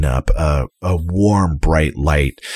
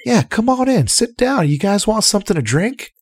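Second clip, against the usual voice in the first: much higher, eager host voice; jolly innkeeper; 13 semitones higher than usual. Acted. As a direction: fuss over the guests happily. Innkeeper Otik serving guests.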